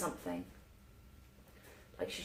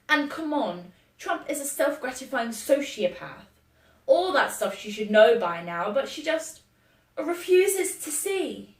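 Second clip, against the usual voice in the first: loud, high exasperated rant; 5 semitones higher, 8 dB louder than usual. Acted. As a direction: argue your case, rising in exasperation. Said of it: "so I go on the offensive".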